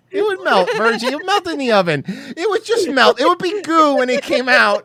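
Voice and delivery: high, insistent protest; high excited. Real, not acted.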